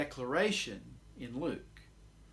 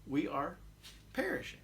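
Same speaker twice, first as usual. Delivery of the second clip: pleading request. Acted. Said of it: "it takes the form of a request".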